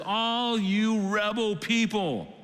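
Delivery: high, slow, drawn-out denunciation; mock self-righteous leader condemning the crowd; morally superior scolding. Moses standing up to the rebel people, superior scolding. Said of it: "He puts himself in the place of moral superiority".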